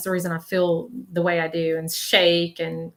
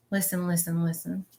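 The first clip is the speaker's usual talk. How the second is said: softly pressing, softer each time; soft urging; 7 dB quieter than usual. Retold or imitated. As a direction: hush and insist. Relaying the spirit's urging.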